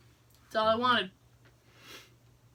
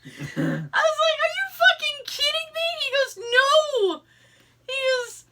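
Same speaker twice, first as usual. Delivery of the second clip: laughing; 6 dB louder than usual. Real, not acted. Retelling while laughing hard.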